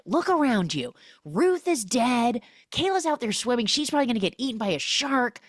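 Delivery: hammed-up yelling at her; screaming. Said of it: "like, screaming".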